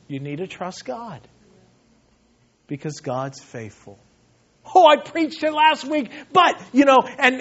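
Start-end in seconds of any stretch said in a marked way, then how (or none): 0.1-4.0 soft, low imitation of a trusting elder; quietly reassure, without any push; Pastor Chuck, calm. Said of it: "the way he smiles and the way he laughs". 4.7-6.6 high-pitched, hammed-up young pastor objecting; mock stressed protest. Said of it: "young guy's just stressed and upset".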